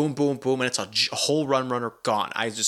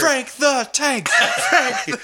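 much higher, slow, loud bellow; laughing shout; 13 semitones higher, 5 dB louder, much slower than usual. Retold or imitated. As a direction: shout the nickname like a party chant. Shouting movie nickname.

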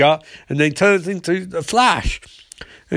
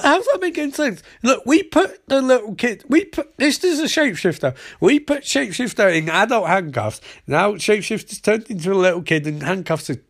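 high exasperated parody Iris; hammed-up high Iris lecturing construction workers; mock exasperated Iris; 6 semitones higher than usual. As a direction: pitch way up, spelling out the obvious. Iris voiced explaining, exasperated.

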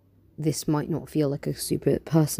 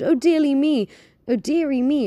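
much higher, louder panicked cry; panicked exclaim; 11 semitones higher, 7 dB louder than usual. Acted. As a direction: fret it out in a flap. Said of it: "exclaimed Mister Smoky".

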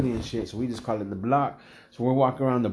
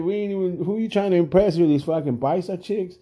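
high, louder exasperated questioning; retold scolding; 7 semitones higher, 3 dB louder than usual. Bouncer berating partner for bringing clothes to work, exasperated. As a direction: lay into him with a raised, disbelieving voice.

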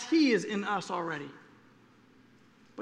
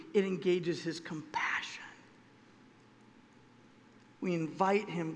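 usual speaking voice

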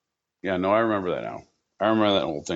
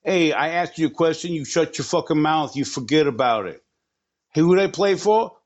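higher-pitched Jersey tough guy, drawling forget about it; Jersey mobster; 7 semitones higher, 3 dB louder, slower than usual. Acted. Podcast host riffing on Doug Flutie's Jersey team, as a higher, put-on Jersey mobster. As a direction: play a pushy New Jersey wiseguy, stretching the last line out slow.

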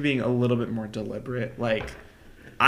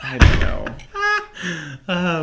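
drawn-out, louder helpless groan of the words; exasperated whine; 5 semitones higher, 7 dB louder, much slower than usual. Real, not acted. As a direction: stretch it out, giving up. Refusing to do the accent.

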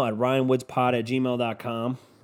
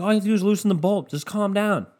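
fast and higher, mock-exasperated; exasperated retort; 8 semitones higher, faster than usual. Telling dad to loosen the bolt, a fast higher exasperated retort. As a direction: rattle it off like you're fed up. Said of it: "I'm like".

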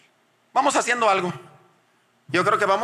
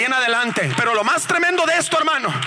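rising to a high, forceful pulpit cry; full-force preaching; 6 semitones higher than usual. Real, not acted. Preacher announcing the most tremendous part of his testimony, full-force preaching. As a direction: build into high, emphatic preaching.

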